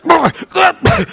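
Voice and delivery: high, strained demonic growl-yelps; demon noises. Retold or imitated. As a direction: imitate someone manifesting a demon. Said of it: "he starts".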